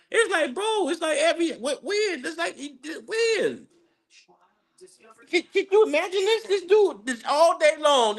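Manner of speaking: high, laughing disbelief; can't believe what he's hearing, voice jumps up; incredulous outburst. Real, not acted. Incredulous rant about rival fan's posts.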